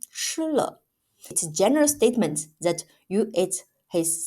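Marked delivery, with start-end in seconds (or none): 0.1-0.8 吃了 said much higher, set apart; stress on 吃了. Shown as a demonstration. Teacher isolating the verb 吃了, stressed high. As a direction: lift 吃了 well above the rest. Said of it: "only focuses on the action".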